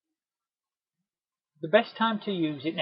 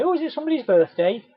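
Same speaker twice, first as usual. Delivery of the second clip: higher, louder bright enquiry; cheery question; 8 semitones higher, 4 dB louder than usual. Pretend. Delivery man noticing birthday cards in the window, cheerily curious. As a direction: ask it with friendly curiosity.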